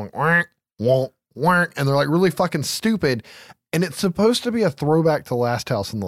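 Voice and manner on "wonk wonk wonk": honking "wonk" like a clown horn; comic vocal honks; clown horn imitation. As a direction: imitate a silly horn, one honk at a time. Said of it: "clown horns".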